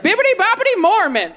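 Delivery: high sing-song spell chant; magic spell. Retold or imitated. Baptizing the dead, high sing-song magic chant. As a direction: cast it like a fairy godmother. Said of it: "you're like".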